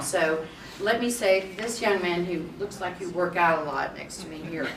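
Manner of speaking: ordinary talk, nothing marked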